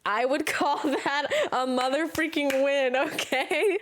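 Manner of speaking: high, laughing gloat; laughing boast. Real, not acted. Bragging to viewers about being serenaded, laughing. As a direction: crow about the win through laughter.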